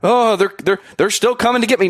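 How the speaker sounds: mock worried Santa. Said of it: "Santa with a hammer going".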